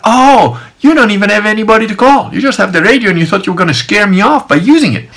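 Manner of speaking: high, scornful calling of a bluff; scornful realisation. Retold or imitated. Man exposes guard's radio bluff, scornful.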